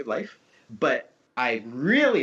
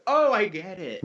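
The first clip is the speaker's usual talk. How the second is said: acted higher-pitched "aha" moment; sudden realization; 4 semitones higher than usual. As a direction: voice someone suddenly getting it. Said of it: "they'd go".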